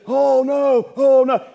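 high, loud mock panic over the news. Preacher mocking news watchers, high loud panicked cries. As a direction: ham up a frightened TV watcher.

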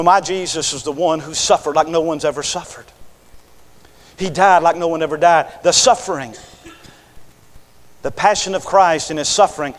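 ordinary talk, nothing marked

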